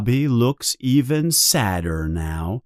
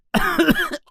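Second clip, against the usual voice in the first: sharp high cough after the burning potion. Acted. Harry coughing after swallowing the potion, a sharp high cough. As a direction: cough as the drink burns your throat. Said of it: "He coughs".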